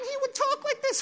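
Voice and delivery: tiny squeaky falsetto; comic high-pitched impersonation, lightly pushed; Mike Tyson. Retold or imitated. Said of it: "he talks in this squeaky voice".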